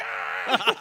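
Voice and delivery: short mock scream, laughing; a playful little shriek; retold scream. Kids in the coat rack, mock scream with a laugh. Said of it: "we were all just, like, screaming".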